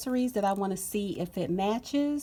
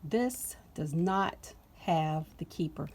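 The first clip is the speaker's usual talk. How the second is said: ordinary talk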